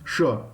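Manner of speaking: "Sure" as sho, with sh. Shown as a demonstration. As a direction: say "Sure" with sh, short. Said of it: "you have to tell sure".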